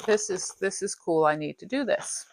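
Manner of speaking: normal talking voice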